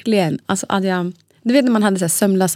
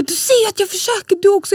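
much higher, shrill-pitched mock outburst; retold angry snap; 12 semitones higher than usual. Exhausted mother snapping at her husband, frustrated. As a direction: throw it at him in frustration. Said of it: "Jag bara".

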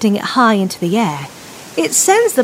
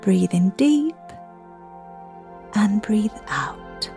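soft spa whisper; 6 dB quieter than usual. Acted. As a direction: guide the children calmly to breathe. Leads children's breathing meditation.

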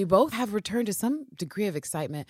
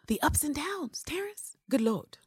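whispering; 9 semitones higher, 4 dB quieter than usual. Real, not acted. Dramatic whispered aside.

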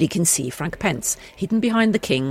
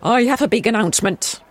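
king voice; 4 semitones higher, 5 dB louder than usual. Shown as a demonstration. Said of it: "I'll do that bit again".

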